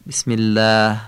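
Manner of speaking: "الله" with a long thin Arabic "ææ"; thin long alef. Shown as a demonstration. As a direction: say الله with a light, fronted, stretched "æ". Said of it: "مثل صدای ا ولی کشیده". («الله» با الف نازک و کشیده)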